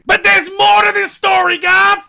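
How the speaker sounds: high, loud showman cry; dramatic shout. Pretend. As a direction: announce it like a big reveal. Dramatic reveal to co-host.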